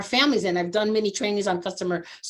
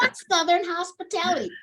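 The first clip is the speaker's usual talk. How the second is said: retold Southern accent, pitched up high; 9 semitones higher than usual. Southern woman at a recruiter training sums it up in a high Southern accent. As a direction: mimic a Southern lady delighting in the idea. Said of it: "with a Southern accent".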